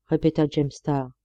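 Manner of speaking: ordinary delivery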